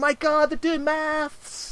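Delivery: high, slowing cry of alarm; mock panic. Townsperson running down spreading the news, mock panic.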